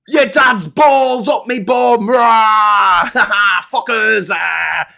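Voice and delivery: high, loud mock yelling of rude words; rowdy shouting mate. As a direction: bellow it like a drunk mate's voice note. Friend's rude voice note acted.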